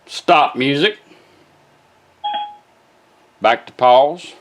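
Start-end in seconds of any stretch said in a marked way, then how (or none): none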